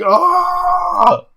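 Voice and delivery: high, loud drawn-out "aah"; imitated howl of rage; mock frustrated scream. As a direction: yell like a sore loser losing it. Imitating the losing player's frustrated scream.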